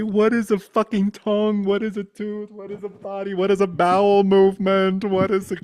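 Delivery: high, loud frantic questioning; mock freak-out. Freaking out at having a body, high frantic shouting. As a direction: wail each question in hammed-up bewilderment.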